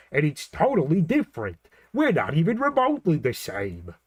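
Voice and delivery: emphatic, put-on Bob's Burgers character voice; Teddy. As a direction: insist in the sitcom cartoon character's voice. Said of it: "Teddy talks like this".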